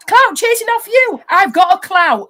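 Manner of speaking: high, loud, drawn-out mocking quote. Woman voicing what Ultra should have said, mocking.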